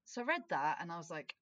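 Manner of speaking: ordinary talk, nothing marked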